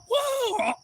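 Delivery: very high imitated kid whoop; acted high-pitched awe; super excited kids. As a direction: squeal in mock amazement. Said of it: "all super excited".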